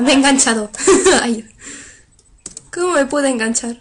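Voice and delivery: ordinary talk, nothing marked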